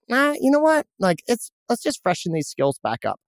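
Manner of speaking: decide on the spot to brush up; decisive self-talk. Retold or imitated. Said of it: "And I thought".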